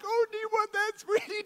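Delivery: dad melting for his daughter; doting dad. Retold or imitated. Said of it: "And I'm like".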